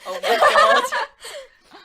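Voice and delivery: high, loud laughing exclamation; laughing outburst. Real, not acted. Co-host reacts to the comedian with big teeth, laughing outburst. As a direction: burst out laughing, drawing it out.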